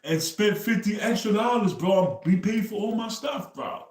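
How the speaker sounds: high, loud, amazed quote of himself; hyped, incredulous bro-talk; excited retelling. Retold or imitated. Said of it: "And I said".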